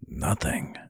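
soft, low, hurt withdrawal; hushed and deflated; wounded. A narrator's reading. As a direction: answer quietly, as if hurt. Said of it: "gave him a wounded look".

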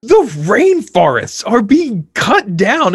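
put-on high, slow excited kid. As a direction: play the worried child breathlessly. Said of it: "they're just like".

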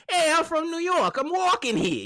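a hint of a brash New York guy; mild New York accent impression. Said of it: "puts on a New York accent".

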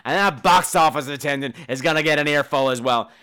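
retold screaming patron, hammed-up; mock screaming rage. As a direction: scream your outrage at the room. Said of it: "is now screaming it across the whole room".

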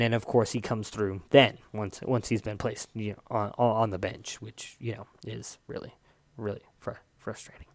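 muttered frustration dying away; trailing off. Real, not acted. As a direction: voice sinking to a whisper of frustration. Fading grumble about benching him.

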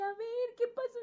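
high, gentle question; caring mentor. Retold or imitated. Mentor at the open door asks what happened, high and gentle. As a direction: coax the story out of her. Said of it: "me dice".

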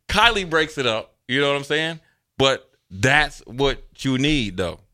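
normal talking voice

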